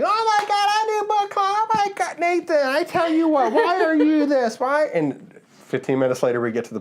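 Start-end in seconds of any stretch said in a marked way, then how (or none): 0.0-4.9 squeaky, much higher, hammed-up old lady gushing; mock an excitable elderly churchgoer, way up high; overjoyed old lady voice, pitched far up; gushing old church lady.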